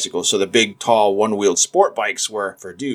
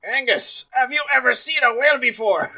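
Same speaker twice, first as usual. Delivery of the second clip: much higher, put-on villager voice; mock Scottish local. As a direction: squeak out a gullible local's question. Acted Scottish villager quote.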